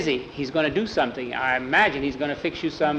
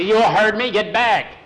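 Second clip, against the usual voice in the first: firm, louder, higher boss command; stern order; 5 semitones higher, 4 dB louder than usual. Acted. Ranch boss ordering his hand back, firm and loud. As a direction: snap the order at him like a boss.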